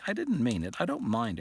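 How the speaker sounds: plain reading voice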